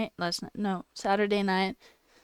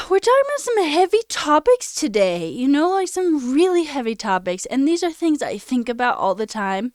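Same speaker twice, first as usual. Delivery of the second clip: higher and louder, voice cracking with tears; tearful, breaking voice; 7 semitones higher, 7 dB louder than usual. Real, not acted. Streamer explaining heavy topics to chat, tearful and breaking. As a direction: push through the words as tears break. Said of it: "trying not to cry".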